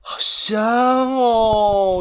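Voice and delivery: long, drawn-out swoon of delight; high, slow, blissful exclamation; savouring the smell. Acted. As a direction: stretch it out dreamily over the aroma. Animals savouring the sauce aroma.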